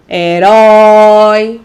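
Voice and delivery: "Herói" with a long, loud RÓI; "Herói" very slowly, holding the open ói; stressed final syllable. Shown as a demonstration. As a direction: stretch and lean on the last syllable of "Herói". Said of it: "Olha onde está a sílaba tônica".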